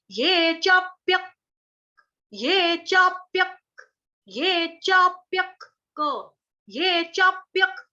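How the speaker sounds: slow, careful ये चाप्यक with half क; chanting teacher demo; half ka, chapyak. Shown as a demonstration. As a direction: sound out चाप्यक slowly, half क joined to य. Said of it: "क्या बोलना है हमको".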